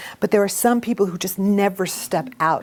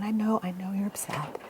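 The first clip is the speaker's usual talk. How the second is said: too calm, too soft; 12 dB quieter than usual. Shown as a demonstration. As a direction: show the wrong way: a limp whisper. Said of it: "if you're way down here".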